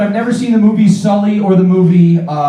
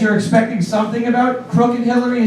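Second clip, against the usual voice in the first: ordinary talk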